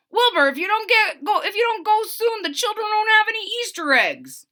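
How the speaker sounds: high, loud hurried shout; high, loud, fast warning. Acted. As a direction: urge him fast and loud. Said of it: "shouted Charles and Henrietta".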